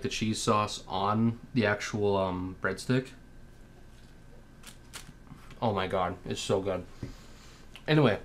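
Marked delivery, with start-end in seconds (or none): 2.6-8.2 talking between bites; chewing while talking. Real, not acted. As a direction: mouth partly full. Seen 0: cheeks bulge and jaw works after bite, breadstick earlier.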